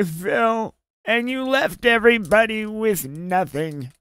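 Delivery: mock-berate Phil like a wronged mother; badly faked woman's voice chewing Phil out; failed female voice. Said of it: "I can't do a female accent".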